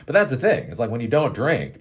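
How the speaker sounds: ordinary talk, nothing marked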